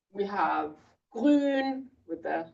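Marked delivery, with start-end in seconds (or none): 1.1-2.5 grün with a rounded ü and throaty r; grün, lips rounded for the ü; umlaut ü. Shown as a demonstration. Teacher showing parents the umlaut in grün. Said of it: "U with the two eyes".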